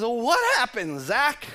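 high, eager wife asking; lightly voiced wife pressing him; mild mock wife, high and keen; curious wife. Wife Liz voiced in the dialogue.

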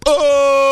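loud, high "oh" breaking into sobs; mock crying wail. Shop lady hearing of the pregnancy, hammed-up wail. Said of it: "she's crying and I'm crying".

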